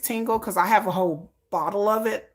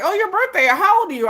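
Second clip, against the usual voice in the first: curious friend; 12 semitones higher, 5 dB louder, faster than usual. Retold or imitated. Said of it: "People be like".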